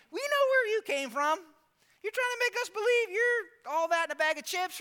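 high-pitched mock indignation; frustrated. Preacher plays the crowd challenging Jesus, frustrated and mocking. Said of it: "These guys get frustrated".

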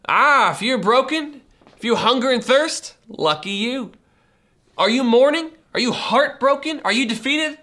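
high, loud rising questions; impassioned preaching. Acted. Pastor calling out to the broken in the congregation, fervent. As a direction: build passion toward the hurting. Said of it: "preaching the surprising blessing".

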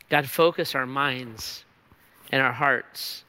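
low, soft closing prayer voice. Acted. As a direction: pray quietly over the band. Leads closing prayer over music.

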